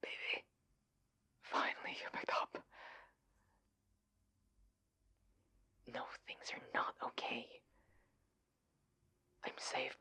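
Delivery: urgent whispered phone call; hushed, secretive whisper. Acted. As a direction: whisper so nobody overhears. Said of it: "I don't know why I'm whispering".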